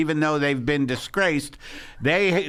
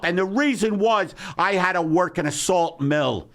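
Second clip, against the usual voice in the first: normal talking voice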